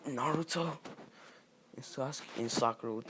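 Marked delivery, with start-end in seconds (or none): 0.1-0.8 high-pitched astonished question; mock gasp of recognition, pitched up; shocked. Villagers seeing Naruto unmasked, shocked. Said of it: "everybody would be shocked when they see him".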